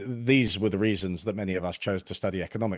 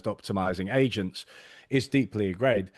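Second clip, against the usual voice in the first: normal talking voice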